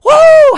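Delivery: loud high woo; preacher's ecstatic shout; shout of praise. Real, not acted. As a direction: belt a joyful woo to the rafters. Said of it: "is that gonna upset your religion if I shout".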